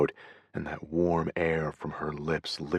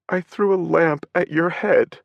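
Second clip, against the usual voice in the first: higher, louder, tearful wail; crying; 12 semitones higher, 6 dB louder than usual. A narrator's reading. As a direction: weep out the confession. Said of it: "she cried".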